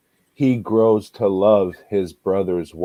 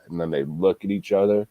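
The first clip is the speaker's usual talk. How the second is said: ordinary talk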